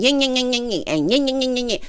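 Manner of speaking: singsong nagging chatter; yapping mock argument; mock bickering. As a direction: imitate nonstop squabbling in a repetitive up-and-down whine. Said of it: "always fussing and arguing".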